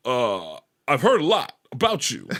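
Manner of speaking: low, portentous mock baritone; Riley Martin. Imitating a radio oddball greeting a stranger by phone, low baritone. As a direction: drop the pitch and measure out the words. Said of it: "but I know he goes".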